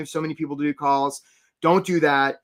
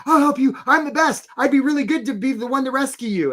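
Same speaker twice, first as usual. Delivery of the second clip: eager job seeker; 8 semitones higher than usual. Retold or imitated. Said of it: "rowing up and saying".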